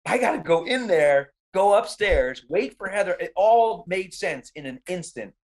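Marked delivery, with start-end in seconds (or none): 0.1-3.1 raised, urgent re-enacted thought; sudden realization. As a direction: let it rise as the plan dawns on you.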